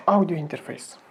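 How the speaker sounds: normal talking voice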